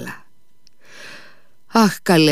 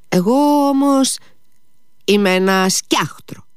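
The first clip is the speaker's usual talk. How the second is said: light, high-voiced self-mockery; playful protest; 7 semitones higher than usual. A narrator's reading. Lise calls herself a scarecrow to Alyosha, playfully. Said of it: "είπε γελώντας η Λίζ".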